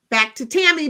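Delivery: ordinary delivery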